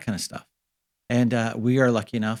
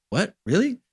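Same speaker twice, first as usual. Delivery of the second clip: much higher, imitating his younger amazed self; mock surprise; 9 semitones higher than usual. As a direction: pitch jumps up in disbelief. His own retold amazed reaction.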